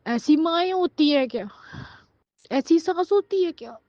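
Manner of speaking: high, tearful lament; real anguished reproach. Real, not acted. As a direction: cry out the hurt as a question. Anguished reproach about mother-in-law. (रोती हुई, ऊँची शिकायती आवाज़)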